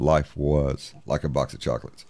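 a touch of Forrest Gump; Forrest Gump quote. Shown as a demonstration. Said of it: "He said".

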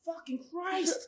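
slow, drawn-out exasperated curse. Retold or imitated. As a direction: let it drag out in disgust. Exasperated under breath.

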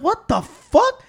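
stunned disbelief. Retold or imitated. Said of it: "So I was like".

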